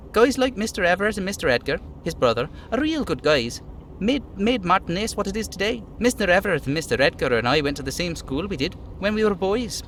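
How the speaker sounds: lightly voiced friendly worker; chatty little worker praising neighbours; little dockhand voice. Voicing the little guy.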